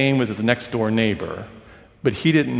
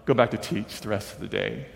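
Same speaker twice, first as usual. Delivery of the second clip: usual speaking voice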